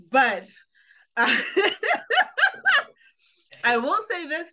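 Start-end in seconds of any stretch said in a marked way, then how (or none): 1.4-2.8 a short squealing laugh; high-pitched laugh. Real, not acted. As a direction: burst into a high giggle. Laughing about the kiss.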